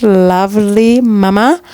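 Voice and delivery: normal talking voice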